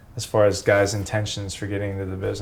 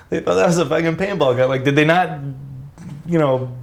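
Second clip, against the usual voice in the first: fast, higher words through laughter; laughing while talking; 4 semitones higher than usual. Real, not acted. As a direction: get it out while cracking up.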